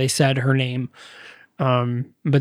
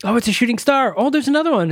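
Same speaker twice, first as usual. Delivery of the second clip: higher, naive mock delight; mock wonder; 8 semitones higher, faster than usual. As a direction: play the clueless stargazer, voice rising. Couple mistaking shootings for stars.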